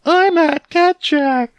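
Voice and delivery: very high, slow cartoon-kid voice; squeaky golly-gee kid; wholesome 1950s kid. [name]'s squeaky voice.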